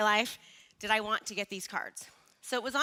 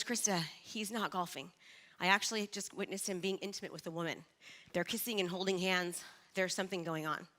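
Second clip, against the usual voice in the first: softer, lower hushed report of bad news; hushed warning; 5 semitones lower, 9 dB quieter than usual. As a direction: break it to her softly and low. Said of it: "And she goes".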